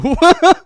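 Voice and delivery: bursting cackle; loud high laugh. Real, not acted. GM cracking up at his own line, laughing.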